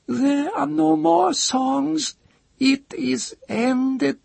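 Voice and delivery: very high, slow, frightened wail; terrified cry. A narrator's reading. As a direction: cry out the end in terror. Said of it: "the chanter cried".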